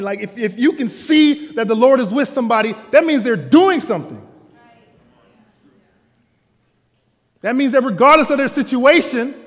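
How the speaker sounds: loud, high preacher's climb; preaching at full force. Acted. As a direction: drive the point home to the congregation.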